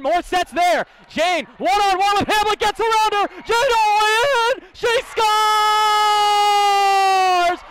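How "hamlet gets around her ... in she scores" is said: long goal yell. Real, not acted. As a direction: hold scores long and loud. Overtime goal call held.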